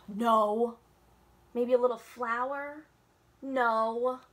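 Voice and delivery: loud fed-up no; frustrated no. A narrator's reading. Girl failing at painting, frustrated and loud. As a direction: reject each try in annoyance.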